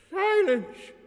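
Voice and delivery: high, loud shout; old man's thundering cry. A narrator's reading. Old priest interrupting the girl, shrieked command. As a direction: shout for order as a frail elder. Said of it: "cried the old man".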